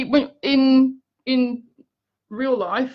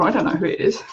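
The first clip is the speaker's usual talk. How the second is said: ordinary talk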